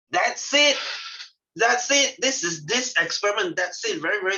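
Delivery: high, bright-eyed wrap-up; excited. Real, not acted. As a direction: sell how easy it is, pitched high. Teacher wraps up excitedly.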